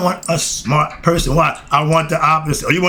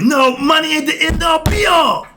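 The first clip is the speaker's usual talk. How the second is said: excited shout; 11 semitones higher than usual. Real, not acted. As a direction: burst out shouting with passion. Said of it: "Sorry, calm down, dog".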